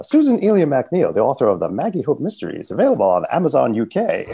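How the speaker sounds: bright-pitched, punchy mock infomercial sell; infomercial plug. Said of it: "I've got the infomercial parts down".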